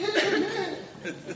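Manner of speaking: high breathy laugh. Real, not acted. Preacher laughing at his Delilah bit. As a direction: laugh at your own joke.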